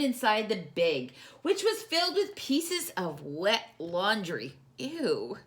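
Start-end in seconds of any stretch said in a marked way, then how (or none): none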